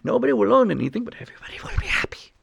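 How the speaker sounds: sinister villain voice, fast, ending in a whisper; a cartoon supervillain sharing his plan; hammed-up evil mastermind, trailing into a whisper; Klaus Schwab. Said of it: "He's really saying".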